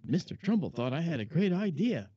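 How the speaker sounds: mock-smug inner monologue; proud inner voice. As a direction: swell up with pride. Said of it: "and I thought".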